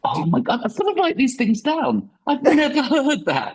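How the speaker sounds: real high-pitched astonished outburst; amazed delight. Real, not acted. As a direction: exclaim it high, thrilled by a new discovery. Delighted reaction to the adage.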